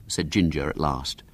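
usual speaking voice

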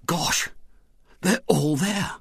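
put-on hushed boyish whisper. A narrator's reading. William whispering from the attic window. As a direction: breathe it so the men below can't hear. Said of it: "he whispered".

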